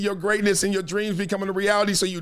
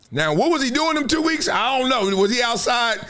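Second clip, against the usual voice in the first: ordinary talk, nothing marked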